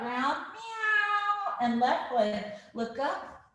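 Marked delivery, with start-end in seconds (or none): 0.5-1.6 long, high Halloween-cat meow; kitty cat meow. Shown as a demonstration. Teacher rounding the spine like a cat, high meowing. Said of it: "kitty cat".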